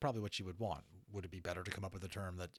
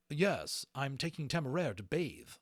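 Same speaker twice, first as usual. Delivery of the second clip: firmly; 6 semitones higher, 5 dB louder than usual. A narrator's reading. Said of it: "Lawrence said firmly".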